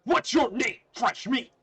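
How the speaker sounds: very loud, high shouted question; big shouted bully voice; booming bully. Acted. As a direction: bellow it at the newcomer. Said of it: "A loud booming voice".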